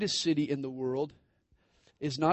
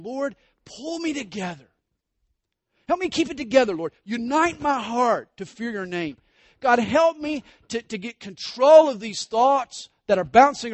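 much higher and louder, crying out to God; fervent prayer; 7 semitones higher, 8 dB louder than usual. Acted. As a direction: plead with rising passion. Voicing David's prayer.

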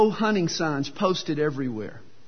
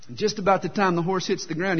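ordinary delivery

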